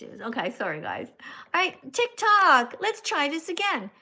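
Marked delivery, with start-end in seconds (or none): 1.5-3.9 excited call. Real, not acted. Calling out to the TikTok audience.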